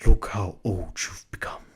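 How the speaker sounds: low, breathy masked villain whisper; menacing whispered imitation; Kylo Ren whisper. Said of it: "comes on the screen and goes".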